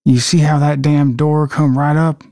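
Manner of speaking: acted Southern US voice; Southern accent. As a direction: say it like a Mississippi local. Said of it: "practice with our Southern accents".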